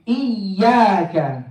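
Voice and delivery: "iyyaka" very slow, doubled y stretched; drawn-out shadda. Shown as a demonstration. Teacher modelling for students to repeat, "iyyaka" slow with held y. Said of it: "So, say".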